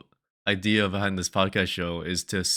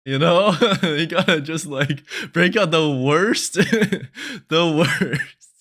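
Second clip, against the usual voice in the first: higher, louder laughing talk; 9 semitones higher, 10 dB louder, slower than usual. Real, not acted. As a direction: giggle through it. Laughing while talking.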